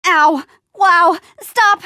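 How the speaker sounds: high, loud yelp of protest; startled shout at a sudden hurt; pained protest. Acted. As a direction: cry out to make it stop. Hurt, telling it to stop.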